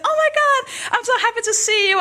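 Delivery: high, delighted squeal of hello; gushing greeting. Acted. Happy best friend greeting her at the station, gushing. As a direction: greet a friend with over-the-top joy.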